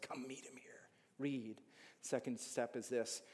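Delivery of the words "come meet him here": soft unvoiced whisper. Real, not acted. Preacher inviting the congregation to meet Jesus, whispered. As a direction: breathe it as a hushed invitation.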